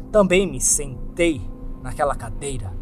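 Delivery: usual speaking voice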